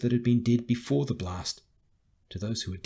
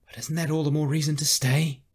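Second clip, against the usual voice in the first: raised, insistent challenge; higher, louder, pressing question; urgent argument; 6 semitones higher, 5 dB louder than usual. Acted. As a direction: push back hard at a superior. Carrie argues against retreat.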